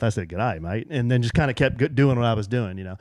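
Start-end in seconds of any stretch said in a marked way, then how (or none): 0.3-0.9 low, soft muttered greeting; under his breath. Retold or imitated. Owner to himself after the lady's reply, muttered under his breath. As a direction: mutter it as a private aside. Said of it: "kinda under my breath".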